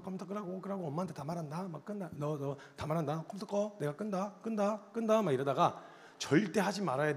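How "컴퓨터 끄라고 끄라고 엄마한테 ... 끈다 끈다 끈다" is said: teasing needle. Retold or imitated. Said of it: "깐족깐족거리면서".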